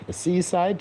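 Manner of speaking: ordinary delivery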